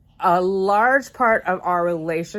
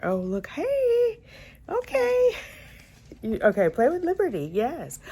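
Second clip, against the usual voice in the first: cooing at a pet; 10 semitones higher, 3 dB quieter than usual. Real, not acted. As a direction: coo at the puppy. High voice cooing at dog.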